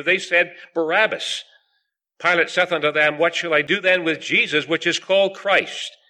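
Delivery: measured, projected Bible reading; scripture reading. Acted. Preacher reading the Gospel of John to the meeting, solemn scripture reading. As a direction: read the verses out firmly to a congregation. Reads scripture aloud to congregation.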